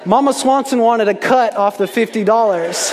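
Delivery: ordinary talk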